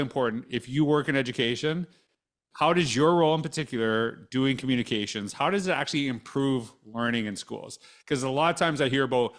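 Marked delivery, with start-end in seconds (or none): none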